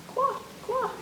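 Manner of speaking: high quacked quack, quack; duck quack. Shown as a demonstration. Teacher playfully quacks the Q sound for kids. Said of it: "Q says is quack".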